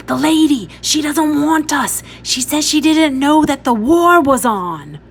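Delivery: put-on high boy's voice; breathless news from the door; boy reporting. A narrator's reading. Jamie reporting back to his sister, high and eager.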